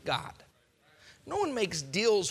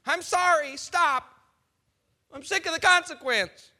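louder, higher whining apology; mock whining plea; 8 semitones higher, 6 dB louder than usual. Sinner begging God to stop the punishment. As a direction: whine to make it stop.

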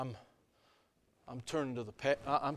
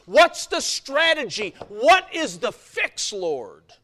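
loud, high sermon delivery; preaching at full force; 8 semitones higher, 14 dB louder than usual. Acted. Preacher to congregation, loud and rising. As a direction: press every line on the congregation.

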